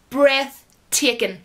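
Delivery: "Breathtaking" stretched out very slowly, every syllable drawn; slow savouring. Real, not acted. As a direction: linger over "Breathtaking" as if tasting it. Repeats it, savouring the word.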